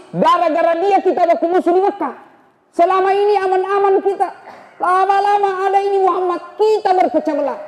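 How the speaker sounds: high, pressed voice of a man set on killing; worked-up Umar. Acted. Umar tells the townsman why he will kill Muhammad, high and tense. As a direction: push it out high and tense, full of grievance.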